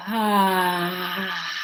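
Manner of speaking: long open-mouthed ah; releasing ah sound; open-jaw ah. Shown as a demonstration. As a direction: let out a full open ah. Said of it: "We wanna really open the jaw".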